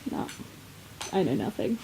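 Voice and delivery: usual speaking voice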